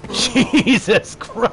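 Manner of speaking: slow, laughing exclamation; amused shock, breaking into laughs; laughing disbelief. Real, not acted. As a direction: draw it out through laughter. Player laughing at fight.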